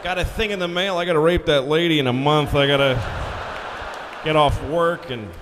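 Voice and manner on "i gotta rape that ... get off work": grudging low loud grumble; reluctant citizen. Acted. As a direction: voice a put-upon guy with chores.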